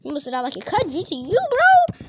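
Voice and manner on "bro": very high squealing gasp; shrieking surprise. Real, not acted. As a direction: shriek at the close call. Shrieking near a hit.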